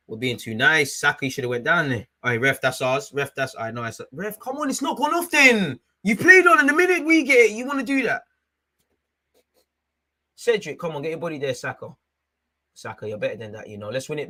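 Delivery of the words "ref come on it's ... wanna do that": high, loud indignant outburst; angry protest. Real, not acted. Fan furious at the referee for playing on. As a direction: rage at the ref's double standard.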